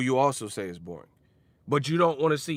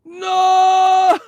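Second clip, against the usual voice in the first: mock scream; 14 dB louder than usual. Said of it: "Y'all fake as fuck".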